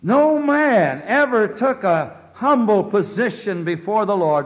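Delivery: high, loud pulpit proclamation; rising preacher's cry; fervent preaching. Acted. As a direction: ring it out to the congregation. Preaching climax opening.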